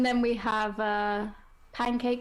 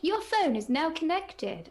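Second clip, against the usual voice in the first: higher-pitched imitation of a voice assistant; pitched-up smart speaker voice; Alexa announcement; 7 semitones higher than usual. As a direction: mimic the device's connection alert. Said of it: "sometimes where it's like".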